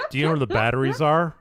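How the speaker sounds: loud, quick, unraised question; gruff dickhead-style question; asshole tone. Shown as a demonstration. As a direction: ask brusquely, fast and loud, keeping pitch down. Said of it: "I sound like an asshole".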